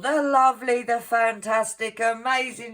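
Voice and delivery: high, loud, gushing announcement; hyped-up shout-out. Pretend. Vlogger hailing the lovely Sharon, hammed-up announcer hype. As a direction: build her up like a star act, loud and high.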